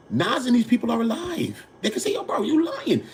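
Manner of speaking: high indignant outburst; indignant rant. Real, not acted. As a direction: push it up high in outrage. Indignant rant quoting [name].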